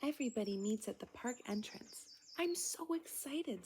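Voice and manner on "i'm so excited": higher, eager little voice; excited little friend. Acted. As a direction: squeal it out with joy. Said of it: "says Tallulah".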